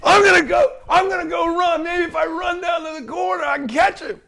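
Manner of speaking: high, loud hammed-up panic; mock heroic dash after the thief; fake frantic urgency. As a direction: play the eager liar rushing off. Employee pretends to chase the buyer.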